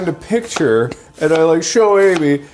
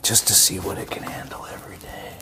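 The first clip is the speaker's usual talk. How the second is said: whisper; 6 semitones lower, 9 dB quieter than usual. Pretend. Dramatic whispered monologue.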